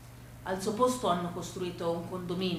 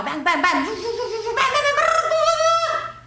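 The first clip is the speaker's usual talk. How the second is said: loud, high bang-bang gunshot noises; gleeful shootout noises, shouted high; mock western gunfire; 11 dB louder, slower than usual. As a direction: play the kid shooting up the TV western.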